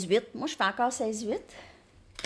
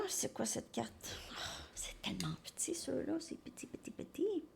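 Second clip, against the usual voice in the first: hushed unvoiced muttering over a tiny card; whisper, squinting at something small; under-the-breath whisper; whisper to herself; 3 semitones higher, 13 dB quieter than usual. Real, not acted.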